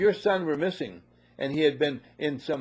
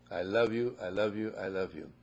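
tender mother; 5 semitones lower, 5 dB quieter than usual. Acted. Mother reassuring returning son.

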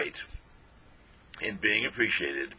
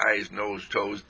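ordinary talk

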